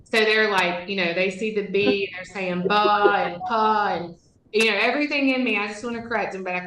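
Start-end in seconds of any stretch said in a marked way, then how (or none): 2.7-3.3 "ba" as buh, a B with an uh on it; "ba", letter sound padded with schwa; schwa added. Retold or imitated. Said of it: "they schwad up too". 3.4-4.0 "pa" as puh, a P with an uh on it; "pa", letter sound padded with schwa; schwa added. Retold or imitated. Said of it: "they schwad up too".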